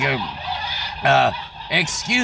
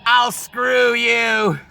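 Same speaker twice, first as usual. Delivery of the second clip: slow, higher, deadpan woman laying down rules; bossy woman's voice; 9 semitones higher, slower than usual. Acted. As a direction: lay down the law, no arguments. Woman locks him in.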